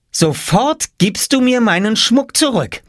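put-on slow, very high furious girl; stern high-pitched command; angry demand. A narrator's reading. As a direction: demand it back this instant.

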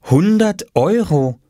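put-on slow, high child price call; drawn-out boyish shout of the price; young boy. A narrator's reading. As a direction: announce the price proudly. Oskar announcing his price.

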